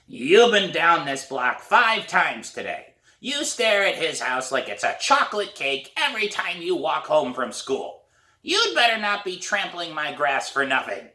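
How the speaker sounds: put-on wag a finger at the kid; hoarse old woman scolding. A narrator's reading. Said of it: "It's a hoarse voice".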